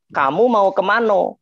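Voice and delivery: Minang accent, "kemana" ending in O as "kemano", slow. Shown as a demonstration. Teacher demonstrating the Minang accent to his guests, "kemana" said "kemano". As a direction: say it like a Minang speaker, final vowel O. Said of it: "Orang Minang".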